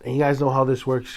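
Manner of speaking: ordinary talk, nothing marked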